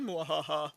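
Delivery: soft hammed-up villain laugh; a quiet gloating mwahaha; evil cackle. Gloating after attacking Little Red.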